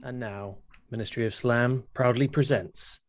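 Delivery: lightly voiced trailer announcer; low, grand mock announcer intro.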